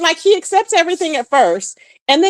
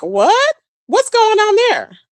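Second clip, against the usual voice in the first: higher, baffled what's going on; shocked what; 7 semitones higher, slower than usual. Retold or imitated. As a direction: throw up a stunned question. Quoting her shocked reaction.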